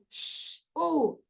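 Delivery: sh hissed softly unvoiced, then u; isolated sounds. Shown as a demonstration. Teacher to class, sounding out letters. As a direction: sound out sh and u separately. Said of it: "Aí tá assim".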